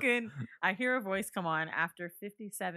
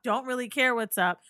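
ordinary talk, nothing marked

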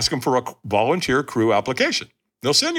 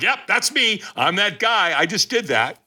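cocky pride; 7 semitones higher than usual. Retold or imitated. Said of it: "I'm like".

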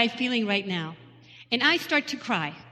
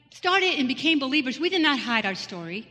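usual speaking voice

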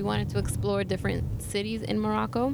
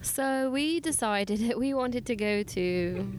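light put-on British accent, slow; slow playful British accent; slower than usual. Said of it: "with a little bit of British accent".

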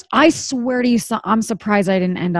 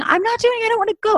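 put-on high panicked child's scream; kid screaming; 11 semitones higher, much faster than usual.

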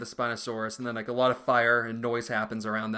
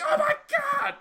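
extremely high squeal of alarm; freaked-out raptor; 3 dB louder, slower than usual. Retold or imitated. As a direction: shriek like a terrified raptor. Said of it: "They're like".

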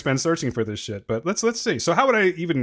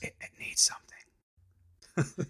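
soft whispered tasting; ASMR whisper; 7 semitones lower, 14 dB quieter than usual. Pretend. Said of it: "then you do your A S M R".

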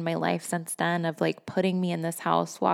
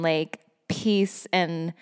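normal talking voice

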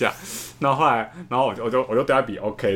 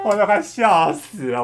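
still laughing; 9 semitones higher, 5 dB louder, slower than usual. Real, not acted.

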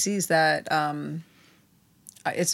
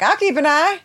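high, louder, mocking parrot; mock Robbie quote; 10 semitones higher, 5 dB louder than usual. Teasing a friend who's asked to keep watch, high mocking imitation. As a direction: lift it high and loud in imitation. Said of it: "Robbie's like".

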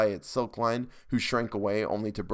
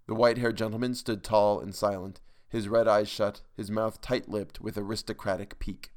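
usual speaking voice

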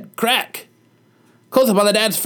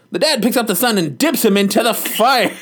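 ordinary talk, nothing marked

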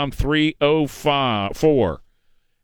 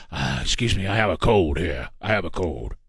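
lower, softer, croaky mock of a sick old man; mocking Biden's cold; 9 semitones lower, 3 dB quieter than usual. Mocking the president as he excuses his cold. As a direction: imitate a hoarse president with a cold. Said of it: "He said".

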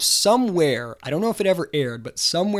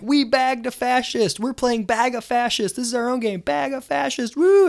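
high mock-triumphant chant ending in a loud whoop; hammed-up protester glee, higher throughout; gleeful protesters; 10 semitones higher, 4 dB louder than usual. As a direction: crow it like a victorious mob. Protesters celebrating capturing him.

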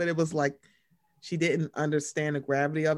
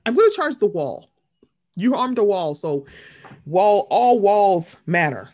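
sarcastic mock; 7 dB louder than usual. Mocking the attorney general.

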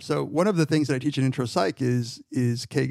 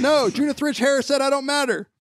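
much higher, hammed-up parent; mock parent refusal; 12 semitones higher, 4 dB louder than usual. Parent refusing a child food, hammed-up high refusal.

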